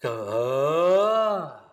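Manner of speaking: long, loud open ah on the breath; sustained ah. Shown as a demonstration. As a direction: ride the sound on the breath like a surfboard. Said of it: "take a breath in".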